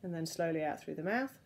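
soft, unhurried relaxation voice with long pauses; guided breathing. Real, not acted. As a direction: softly lead them through each breath. Leads slow breathing exercise.

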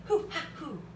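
puffing breaths at the heat; hot-drink puffs. Real, not acted. Burned by hot coffee, puffing breaths. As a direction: blow out after a hot sip.

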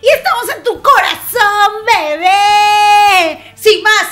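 high, loud hyped show opener; hype host intro. Acted. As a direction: pump the audience up.

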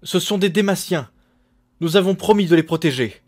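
high, loud insistence; urgent protest. A narrator's reading. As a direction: plead the cause forcefully to a comrade. She stops a comrade drawing. (protestation pressante, voix haute et forte)

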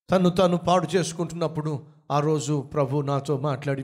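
ordinary talk, nothing marked

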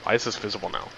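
ordinary talk

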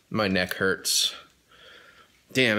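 ordinary talk

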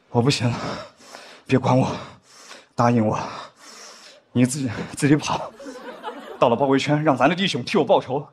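lightly voiced dying soldier, low; mock tragic plea; a hint of a melodramatic wounded comrade; wounded hero. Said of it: "一定会有个说".

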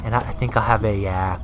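low, soft creep savoring his order. Pretend. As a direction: drag out the "ah, yeah" like a creep. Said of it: "that's the creep voice".

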